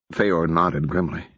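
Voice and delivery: plain reading voice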